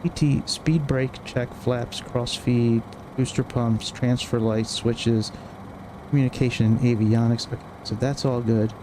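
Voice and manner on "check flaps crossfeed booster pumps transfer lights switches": ordinary talk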